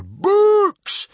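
very high, loud explosion; furious snort. Acted. Chief too annoyed for calm, furious snort. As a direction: explode in scorn. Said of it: "he snorted furiously".

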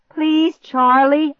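high, loud plea; desperate begging. A narrator's reading. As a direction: beg the dog to move. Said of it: "I begged".

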